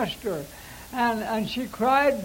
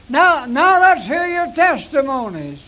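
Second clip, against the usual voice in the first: louder rallying call to the congregation; announcing call; 2 semitones higher, 7 dB louder than usual. Shown as a demonstration. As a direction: call out for testimonies like a preacher. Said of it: "That's the way I said it".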